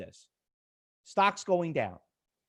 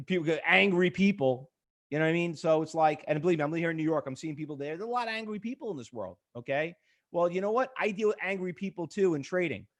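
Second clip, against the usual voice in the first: ordinary talk, nothing marked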